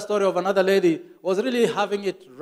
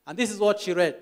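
usual speaking voice

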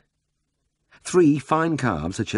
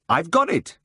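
much higher, a triumphant burst; sudden exclamation; 10 semitones higher, 4 dB louder than usual. A narrator's reading. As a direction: blurt it out as the idea hits. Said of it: "he exclaimed".

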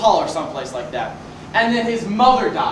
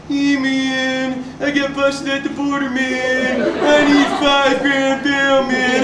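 stoned friend; 2 semitones higher, much slower than usual. Retold or imitated. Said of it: "He calls me up and says".

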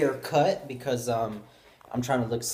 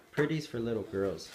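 ordinary delivery

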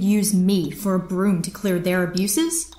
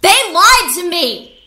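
slow, much louder and higher, bursting out; angry outburst; 10 semitones higher, 9 dB louder than usual. Acted. Captain arguing with her friend about fleeing, furious outburst. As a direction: spit out the betrayal at full force.

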